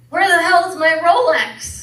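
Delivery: high, loud shocked outcry; shocked lawyer. A narrator's reading. Lawyer wailing over his lost Rolex. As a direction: cry out, horrified about the watch. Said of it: "at his bloody stump and says".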